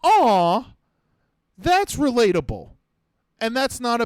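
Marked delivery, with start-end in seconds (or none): 0.0-2.7 higher, tender aw; sympathetic aw. Retold or imitated. The whole crowd cooing at the nervous girl, pitched up. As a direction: coo at her with sympathy. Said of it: "we all can go".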